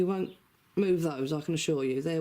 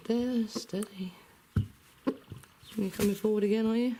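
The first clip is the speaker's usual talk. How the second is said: higher coaxing voice to a chicken; pet talk voice; 7 semitones higher than usual. Real, not acted. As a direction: coax the bird gently, pitched up.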